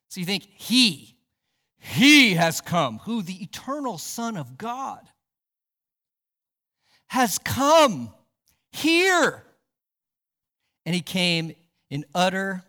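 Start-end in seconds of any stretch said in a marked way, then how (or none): 0.6-3.0 HE punched out and held long; slow, loud "He has come", each word weighty; emphatic proclamation. Real, not acted. Preacher stressing key three words. 7.1-9.4 "come" and "here" lifted high and loud; emphatic proclamation. Real, not acted. Climax of preacher's proclamation.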